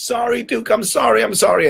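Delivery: high, hurried begging; acted high-pitched pleading; pleading apology. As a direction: beg the duke for mercy. Beaten man begs the duke.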